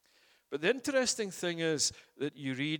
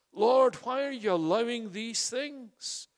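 pleading cry; 7 semitones higher, 5 dB louder than usual. Acted. Cries out to God.

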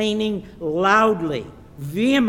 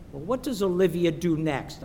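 ordinary delivery

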